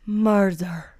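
Scottish-tinged "Murder." dragged out long; Forensic Files narrator. Retold or imitated. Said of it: "the way he drags it out".

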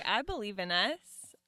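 normal talking voice

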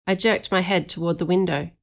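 usual speaking voice

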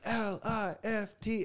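high, drawn-out letters without full volume; stretched, high-pitched imitation hype call; mock crowd-hyping shout. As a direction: imitate a frontman calling out the acronym. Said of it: "they would, like, shout the acronym".

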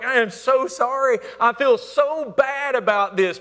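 teen apologizing to parents, hammed-up sorry; mock contrition. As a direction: overplay the remorse. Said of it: "they're like".

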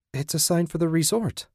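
bright and high, pleased at the sign; happily. A narrator's reading. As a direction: lift the pitch with relief and delight. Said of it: "Susan said happily".

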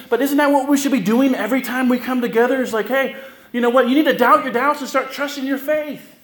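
rally the congregation, voice lifted high; full-force preaching. Acted.

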